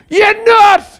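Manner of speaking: high, loud yell; shouted retort. Retold or imitated. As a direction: snap back sharply, high and loud. Shouting at Brian in retelling.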